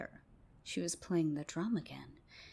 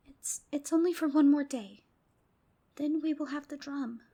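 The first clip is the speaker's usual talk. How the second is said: put-on much higher, louder eager kids; excited girls; 8 semitones higher, 7 dB louder than usual. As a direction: blurt it out, high and eager. Girls justifying themselves.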